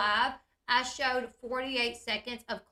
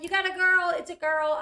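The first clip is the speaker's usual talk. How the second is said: excited announcement; 6 semitones higher, 6 dB louder than usual. Retold or imitated. Alana announcing the girl excitedly.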